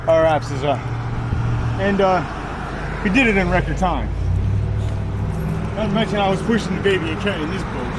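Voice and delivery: panting after the hike; winded, gasping between words; out of breath. Real, not acted. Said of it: "sorry, I'm out of breath".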